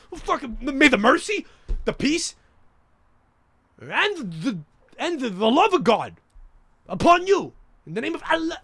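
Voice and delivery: high, rising one-upmanship greeting; escalating mock greeting. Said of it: "It's like a competition".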